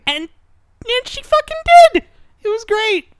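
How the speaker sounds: very high, squealed delight; excited gushing. Real, not acted. Dog lover thrilled that character accepted dogs, excited squeal. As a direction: burst out in high-pitched joy.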